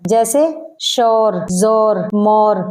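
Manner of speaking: शोर जोर मोर with an open o, no oo; plain o vowel. Shown as a demonstration. Teacher demonstrating the o vowel, slowly. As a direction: say शोर with an o, not oo. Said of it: "सिर्फ वाव की आवाज के साथ".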